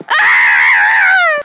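very low, loud monster shout; loud low bellow; deep shout. Acted. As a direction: roar it out low.

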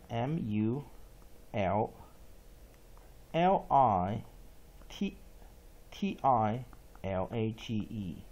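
ordinary talk, nothing marked

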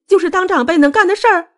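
very high, wailing retort; shrill, distraught reproach; tearful accusation. A narrator's reading. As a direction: throw it back in a high, upset cry. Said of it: "穆小薇大哭道".

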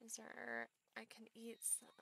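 unvoiced whisper to herself. Real, not acted. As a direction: whisper it under your breath. Whispered aside.

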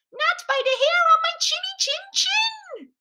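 very high, squeaky piglet voice; little pig's cry. A narrator's reading. Little pig refuses the wolf at the door, crying out. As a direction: squeak out a defiant storybook piggy refusal. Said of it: "cried the little pig".

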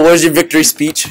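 ordinary delivery

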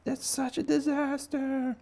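very high, slow wailing lament; mock despair. Onlookers watching the bunny's disaster, high mock wail. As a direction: wail in hammed-up horror. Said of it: "And, like".

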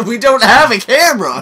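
high, loud protest; exasperated yell. Pretend. Answering friend who says leave the camera, exasperated yell. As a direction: shout it in disbelief.

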